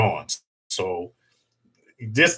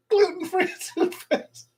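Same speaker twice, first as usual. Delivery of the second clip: higher, words broken by laughter; laughing; 5 dB quieter than usual. Real, not acted. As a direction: say it through laughter. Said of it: "repeating while laughing".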